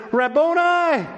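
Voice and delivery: high, drawn-out cry of recognition; overjoyed cry. Acted. Woman recognizing her risen teacher, overjoyed cry. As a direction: cry it out high, as to someone thought lost.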